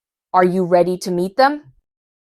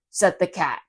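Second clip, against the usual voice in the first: ordinary delivery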